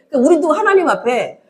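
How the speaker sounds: ordinary delivery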